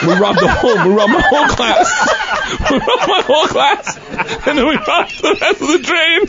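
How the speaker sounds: high, breaking laughter through the words; can't stop laughing as he tells it; laughing hard. Real, not acted. Telling the crowd about robbing his class, laughing high. Laughing while recounting robbery.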